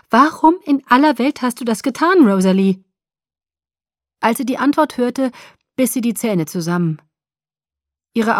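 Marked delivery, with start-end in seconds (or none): 0.1-2.8 loud, high, appalled demand; blurted out. A narrator's reading. Alice confronts Rosalie on the phone, blurting it out appalled. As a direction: burst out with it, pushing the voice up. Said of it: "stieß sie hervor".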